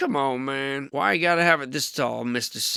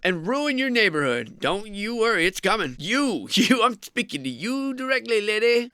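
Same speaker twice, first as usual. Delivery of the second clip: mock warning; 7 semitones higher than usual. Mock warning aimed straight at viewer.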